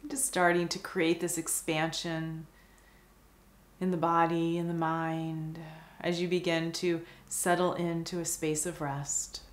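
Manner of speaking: calm meditation guide, long pauses between phrases; guided meditation. Acted. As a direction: guide the listener gently into stillness, unhurried and even.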